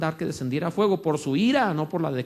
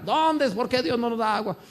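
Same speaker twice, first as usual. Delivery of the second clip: whining people; 6 semitones higher than usual. Retold or imitated. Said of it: "cada vez volvían a llorar".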